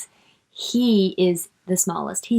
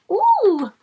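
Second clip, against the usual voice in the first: high, loud delighted ooh; thrilled ooh; excited ooh; 12 semitones higher, 5 dB louder than usual. Real, not acted. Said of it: "Good expression".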